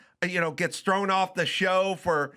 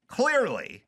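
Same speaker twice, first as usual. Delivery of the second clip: ordinary talk, nothing marked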